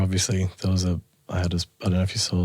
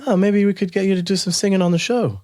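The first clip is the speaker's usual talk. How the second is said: higher, smirking mock suggestion; 9 semitones higher than usual. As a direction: tease him, slyly and higher. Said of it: "said with a smirk".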